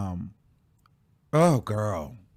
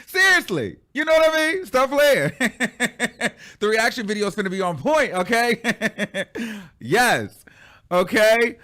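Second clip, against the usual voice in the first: high and louder, laughing through the words; 5 semitones higher, 3 dB louder than usual. Real, not acted. Laughing to viewers about her new teeth, giggling high. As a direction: laugh it off with delight.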